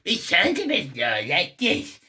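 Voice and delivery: put-on cartoon parody voice; Marge Snospis. Shown as a demonstration. Showing the Marge voice he is not doing, put-on parody. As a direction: demonstrate a strange strained character voice. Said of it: "Marge Snospis voice from Snospis Air".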